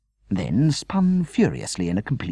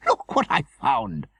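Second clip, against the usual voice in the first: delighted; 3 dB louder, slower than usual. A narrator's reading. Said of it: "she cried in delight".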